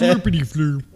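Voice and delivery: high mock chef gibberish through laughter; laughing nonsense chef talk; Swedish Chef impression. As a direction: babble like the Muppet chef. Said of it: "Like the Swedish Chef?".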